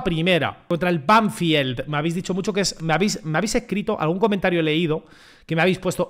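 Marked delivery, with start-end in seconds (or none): none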